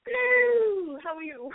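high, drawn-out hammy British hello; hammed-up Mrs. Doubtfire British greeting; British accent. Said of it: "British accent on today".

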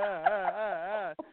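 slow, soft put-on sobs to stop the whooping; fake crying. Kid faking sobs at mom, slow soft fake crying. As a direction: fake the cry so she'll stop. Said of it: "I'd be like".

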